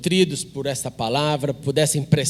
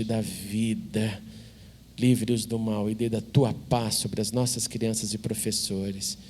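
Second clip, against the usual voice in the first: low, soft, even praying voice; hushed spoken prayer to God; congregational prayer; 7 semitones lower, 4 dB quieter than usual. Real, not acted. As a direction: lead a quiet, reverent prayer, settling lower toward the amen.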